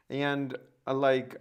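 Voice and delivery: ordinary talk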